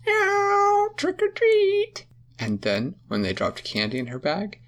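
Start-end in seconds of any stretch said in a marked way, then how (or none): 0.1-2.1 high squeaky kitty voice; much higher, louder meowing cat girl. Acted. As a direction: mew it like a cute cat. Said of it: "she would say".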